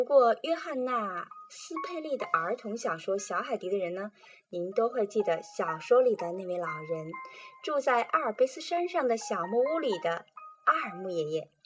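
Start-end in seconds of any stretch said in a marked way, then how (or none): none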